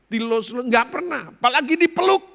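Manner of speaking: high, loud emotional protest; emphatic disbelief. Retold or imitated. Son telling pastor he was never hugged, emphatic. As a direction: build to a high, loud exclamation.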